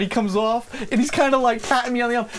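usual speaking voice

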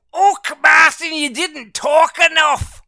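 high, loud mock Scottish complaint; Jimmy, Scottish accent. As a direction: holler it in a hammed-up, drawn-out Scottish accent. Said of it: "You always go".

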